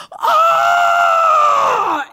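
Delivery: sudden high yelp of alarm; a startled cry acted out in a story; retold scream. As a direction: shriek like something just hit him.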